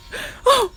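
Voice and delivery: sharp high gasp through laughter. Real, not acted. Host overcome by memory, high gasp. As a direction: catch your breath mid-giggle. Overcome by own funny story.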